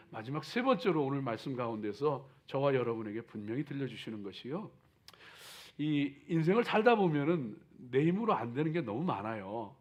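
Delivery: ordinary talk, nothing marked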